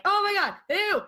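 high, squealing disgust; freaking out. Retold or imitated. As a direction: squeal in grossed-out panic. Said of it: "I'm freaking out".

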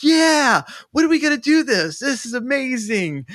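high, gushing retold enthusiasm; mock excitement. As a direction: squeal it like eager fans. Retells the pair's eager reaction.

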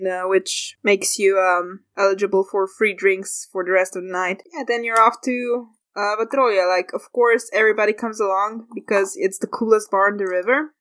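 usual speaking voice

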